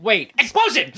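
hammed-up action-movie alarm; mock alarm. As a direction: fake sudden panic. Mocking movie moment.

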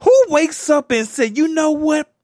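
high, loud disbelieving rant; who-does-that outrage, way up high; escalating comedy rant. Real, not acted. Incredulous rant about running.

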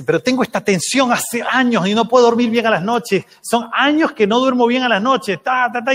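retahíla quejosa y aguda, imitada; contando desgracias. Retold or imitated. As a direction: imita a quien descarga años de penas. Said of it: "contarme todas sus desgracias". (lamento exagerado sin parar)

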